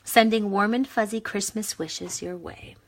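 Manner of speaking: slow, sweet card reading voice; reading the sentiment. Shown as a demonstration. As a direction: read it out sweetly. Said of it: "So it says".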